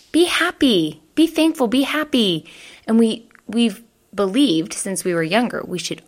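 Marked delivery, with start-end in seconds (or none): 0.1-2.5 higher mock parental urging; parents telling us. Parents telling kids to cheer up, mock higher voice. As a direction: pitch it up like a well-meaning parent. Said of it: "people telling us".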